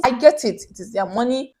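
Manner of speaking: ordinary talk, nothing marked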